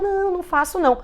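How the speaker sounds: negação em tom bem mais agudo; negação encenada. Acted. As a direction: imite um pai negando prontamente, voz aguda. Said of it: "A pessoa fala". (negando, com voz aguda imitada)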